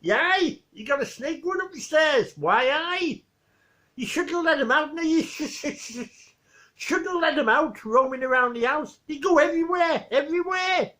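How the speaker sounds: high, excited Geordie with laughs; Geordie accent. Retold or imitated. Geordie guy warns Victor about the snake, laughing Geordie accent. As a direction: Geordie lad breaking into giggles as he warns. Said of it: "the Geordie guy".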